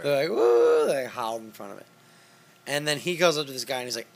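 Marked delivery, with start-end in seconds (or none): 0.4-0.9 high whooping howl; mock howl. Imitating politicians howling at the owl effigy, a high whoop. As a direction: howl like a cultist at a fire.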